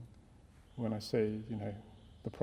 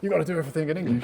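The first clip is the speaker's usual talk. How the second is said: louder, higher, fast mock demand; mock insistent convert; 5 semitones higher, 9 dB louder, faster than usual. Priest mocking his own hypocrisy, loud mock insistence. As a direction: lampoon a pushy zealot. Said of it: "suddenly say".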